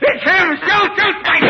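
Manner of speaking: high, loud frantic yelling; panicked shout. Acted. Henchman seeing the Shadow return, frantic shout. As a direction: shriek at him to fire.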